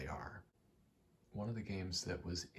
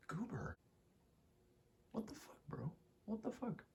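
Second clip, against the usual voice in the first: high-pitched disbelief at a pet; squeaky-high, puzzled exclaiming without shouting; real bewilderment; 10 semitones higher than usual. Real, not acted. Exclaims at Goober mid-recording.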